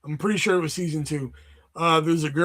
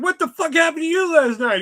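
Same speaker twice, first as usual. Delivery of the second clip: mock confrontation; 9 semitones higher, 6 dB louder than usual. Retold calling out friend.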